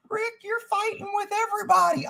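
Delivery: very high, whiny mock voice; mocking critic. Streamer mimicking somebody who says he fights everybody, mocking falsetto. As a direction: imitate a nagging critic way up high. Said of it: "So I know somebody's saying".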